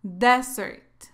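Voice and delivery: "Desert" as DEZ-ert, stress on the first syllable; strong open e at the start of "Desert"; careful teacher's model of "Desert", stressed up front; single-S pronunciation. Shown as a demonstration. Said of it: "se pronuncia así".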